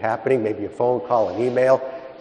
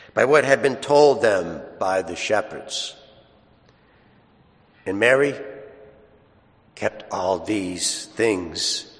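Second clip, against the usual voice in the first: solemn, slow scripture reading; Gospel reading; slower than usual. Acted. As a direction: proclaim it from the ambo.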